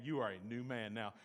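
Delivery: ordinary delivery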